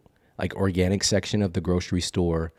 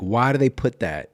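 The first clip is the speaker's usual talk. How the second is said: ordinary delivery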